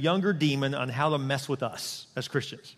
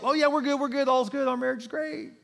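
high, over-bright brush-off; a spouse hiding trouble, chirping all is well; fake cheer; 8 semitones higher than usual. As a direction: pretend all is fine, chirpy. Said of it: "You just put the veil up".

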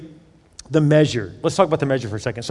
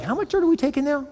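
higher, disbelieving question; incredulous homeowner; 9 semitones higher, 4 dB quieter, faster than usual. Retold or imitated. Homeowner asks the dirt-digging visitor, incredulous. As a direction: sound baffled at the growing shovel.

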